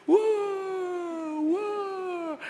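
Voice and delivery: high, drawn-out mock wail; long high keening woo; imitated wailing. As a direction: wail like a hired mourner. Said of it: "they have professional wailers".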